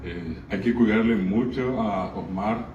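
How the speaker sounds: ordinary talk, nothing marked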